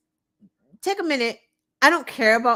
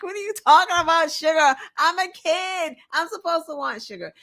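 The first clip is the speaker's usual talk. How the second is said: put-on higher, fast, put-upon child; exasperated kid voice; 7 semitones higher than usual. The boy's imagined retort to DeSantis, fast, high and exasperated. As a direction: whine like a kid who can't believe it.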